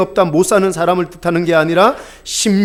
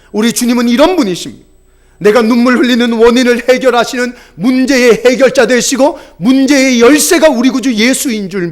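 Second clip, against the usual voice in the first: rising, louder sermon climax; preaching at full force; 6 semitones higher, 6 dB louder than usual. Acted. Pastor urges the congregation to kneel before Jesus, preaching loudly. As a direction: preach it louder and higher to the blessing.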